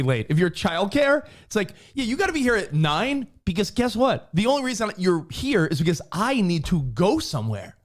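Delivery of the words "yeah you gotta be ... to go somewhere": high-pitched, pointed imagined telling-off; acted exasperation, voice pitched up; exasperated parent. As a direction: lay down the law as a fed-up parent. Imagined parent lecturing late childcare.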